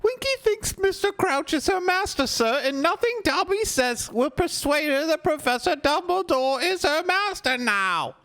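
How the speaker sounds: very high squeaky elf voice, earnest; a pleading little house-elf squeak; high-pitched elf pleading for his friend; Dobby the house-elf. Acted. Dobby pleading about Winky.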